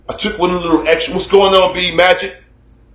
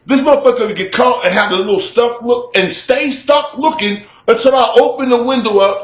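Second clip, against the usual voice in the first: ordinary delivery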